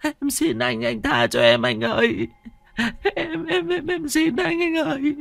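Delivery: very high, trembling begging; terrified stammering plea. Acted. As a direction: stammer for your life. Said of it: "con Hạnh lắp bắp".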